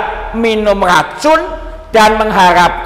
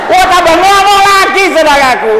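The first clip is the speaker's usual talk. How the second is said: mock exclamation; 12 semitones higher, 7 dB louder than usual. Mock reaction.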